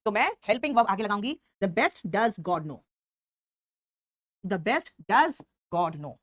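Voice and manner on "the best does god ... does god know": "the best does God know" very slow, stressing "best" and "does"; slow emphatic dictation. Shown as a demonstration. Teacher modelling the inverted sentence, slow and stressed. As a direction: lay each word down slowly. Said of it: "उस word पर मैं जोर देना चाह रही हूँ".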